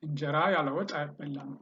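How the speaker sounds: fast, all in one breath group; fluent run-through. Shown as a demonstration. Teacher repeating the Amharic sentence for learners, quick and fluent. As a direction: run the words together quickly without pauses.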